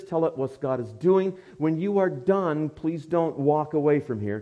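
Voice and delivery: usual speaking voice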